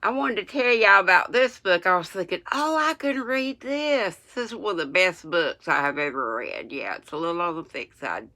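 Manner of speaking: talking without teeth in; gummy toothless speech. Real, not acted. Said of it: "I forgot I don't have my teeth in".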